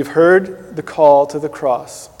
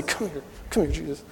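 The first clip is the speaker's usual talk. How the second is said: hushed coaxing; 14 dB quieter than usual. Retold or imitated. Disciple quietly beckoning Jesus to step aside, hushed and sly. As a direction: coax him over quietly, like a schemer.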